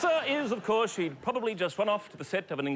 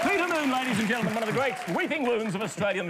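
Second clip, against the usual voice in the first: fast, higher showbiz announcement; a host announcing a guest in a quick higher voice; announcer intro; 6 semitones higher, 4 dB louder, faster than usual. Acted. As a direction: rattle off the name like a compère. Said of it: "introduces guest".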